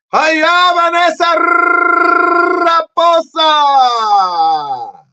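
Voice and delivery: high, loud, very slow "Raposa"; shouted greeting. Real, not acted. Host calling out to viewer Vanessa, drawn out. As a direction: stretch her surname long.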